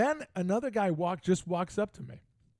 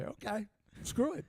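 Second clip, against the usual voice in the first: high, soft "screw it"; offhand high-pitched aside; 7 semitones higher, 4 dB quieter than usual. Retold or imitated. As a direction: toss it off softly in a raised pitch. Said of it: "Just like that".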